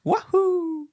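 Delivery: high squeaky Mario cheer; Mario impression. Showing his co-host his Mario, high cheer. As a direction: whoop it like the plumber leaping. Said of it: "do a good Mario impression".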